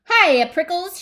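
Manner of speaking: high, loud, friendly holler; shouted greeting. Acted. Jackrabbit dashing past the cactus, shouted. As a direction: holler a teasing hello in passing. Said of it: "she shouted".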